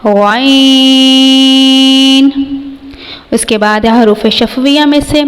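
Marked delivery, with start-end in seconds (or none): 0.0-2.3 غین held long and gargled from the upper throat; drawn-out throat letter. Shown as a demonstration. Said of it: "ابتدائی حلق سے ادا ہوگا". (غین ابتدائی حلق سے)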